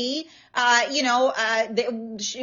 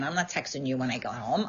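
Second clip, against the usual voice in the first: mock grumble; 9 semitones lower, 9 dB quieter, faster than usual. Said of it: "they look at me like".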